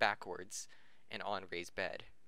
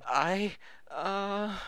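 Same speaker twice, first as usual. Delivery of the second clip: flustered stammer; 10 semitones higher, 6 dB louder than usual. Acted. Flustered Mike quote.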